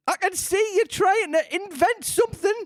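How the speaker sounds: pigeon complains about serpents; high pigeon voice. A narrator's reading.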